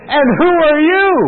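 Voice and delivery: put-on young punk squaring up; cocky challenge. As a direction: throw it at the old man like a brash young punk. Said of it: "And then this guy says".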